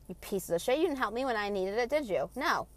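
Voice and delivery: ordinary talk, nothing marked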